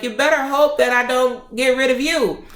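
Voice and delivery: heated, high-pitched warning; retold threat. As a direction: threaten her, worked up and high. Angry threat to the doctor.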